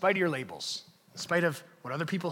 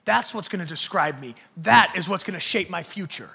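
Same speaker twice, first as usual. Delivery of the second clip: loud preacher voicing a defiant believer; defiant declaration; 3 semitones higher, 8 dB louder than usual. Congregant walking out rejecting old labels, defiant declaration. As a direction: build from firm refusal to full-force, raised proclamation. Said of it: "walk out of this room saying".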